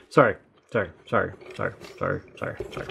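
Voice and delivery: sorry fading to a whisper; fading apology. Pretend. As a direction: trail off from soft to whispered.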